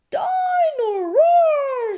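high, loud, drawn-out cry; stretched squeal of delight; excited little George. A narrator's reading. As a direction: shout the toy's name like a thrilled small child. George cries toy's name.

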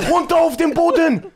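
loud barked order to get down; police command shout. Retold or imitated. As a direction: yell it like a raiding cop. (laut gebrüllter Polizeibefehl)

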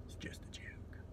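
whispered aside. Real, not acted. Comedian whispering an aside to the crowd. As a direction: breathe it like a secret. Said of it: "Don't know why I'm talking like this".

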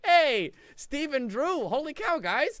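loud, slow, drawn-out call; hailing a friend who just arrived; excited greeting. Real, not acted. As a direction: stretch the name out as you call it. Greeting a new super chat donor.

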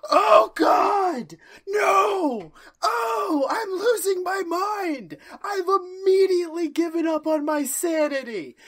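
high, loud crying wail; mock breakdown. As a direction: wail like a man losing his mind.

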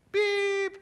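high squeaky mock beep into the phone; answering machine beep. Caller mimicking his own answering machine beep. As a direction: chirp it like a machine tone. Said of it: "it would sound something like this".

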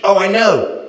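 put-on condescending author in a thick staccato accent. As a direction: talk down to him, loud and high. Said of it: "his eyebrows dipping condescendingly".

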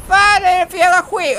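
high, loud yelp of protest; alarmed outburst. Real, not acted. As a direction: blurt it out at a high pitch. Cursing after snake scare.

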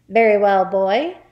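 booming bully; loud headmistress. A narrator's reading. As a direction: boom it at the boy.

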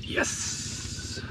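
ordinary talk, nothing marked

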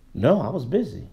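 low, soft, shrugging "I was busy"; dismissive excuse. Retold or imitated. Imam imitating a sister's careless excuse, low and soft. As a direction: brush it off like it's nothing. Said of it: "she'll say it like that".

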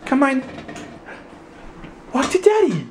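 high-pitched cheering on. Real, not acted. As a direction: coax a little one towards you. Coaxing toddler to walk.

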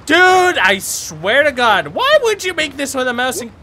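loud, heated complaint; fed-up gamer outburst; exasperated rant. Real, not acted. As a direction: let frustration burst out at the controls. Raging at the game controls.

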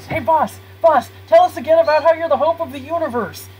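high, loud, excited sidekick voice; eager underling. Acted. Underling begging the boss for his speech, eager. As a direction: pester the boss with hammed-up enthusiasm.

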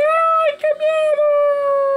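high, drawn-out hammed-up scream of fright; high slow mock-terrified wail; terrified cry. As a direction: wail it like a cartoon coward fleeing. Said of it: "empiezan a correr y decir".

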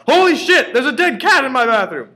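very high, loud horrified shriek; shocked scream. Acted. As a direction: scream it in sudden horror. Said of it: "we heard him cry".

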